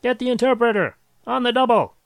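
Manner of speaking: high, loud shouted order; yelled. A narrator's reading. Launching agent summoning the interpreter, yelling. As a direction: yell urgently for help across the launch site. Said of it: "took one look and yelled".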